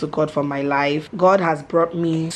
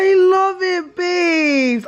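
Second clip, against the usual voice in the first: touched; 10 semitones higher, 3 dB louder, much slower than usual. Real, not acted. Tearful thanks to husband.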